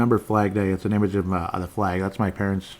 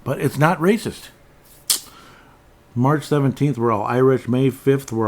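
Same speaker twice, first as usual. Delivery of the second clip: high-pitched, sing-song mock protest; put-on protesting voice; 4 semitones higher, 4 dB louder than usual. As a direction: insist in a silly raised voice, punching each denial. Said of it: "why I'm talking like that".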